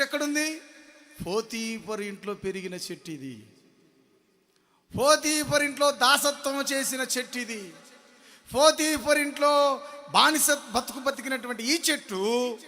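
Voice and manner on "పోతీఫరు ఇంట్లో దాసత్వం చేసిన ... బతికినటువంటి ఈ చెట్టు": loud, raised sermon cry; high, ringing pulpit delivery; full-force preaching. Real, not acted. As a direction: proclaim it loudly to the congregation.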